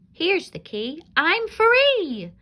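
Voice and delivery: high, loud, jubilant; joyful shout. Acted. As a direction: shout with relief and joy. Hansel freed from cage.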